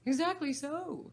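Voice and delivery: high, loud Hatter agreement. Acted. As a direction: snap it back as the Hatter. Hatter voiced in story.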